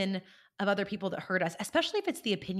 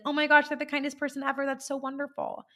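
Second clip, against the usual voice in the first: gushing delight; 6 semitones higher than usual. Retold or imitated. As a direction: burst out thrilled and quick. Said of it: "Or you can be like".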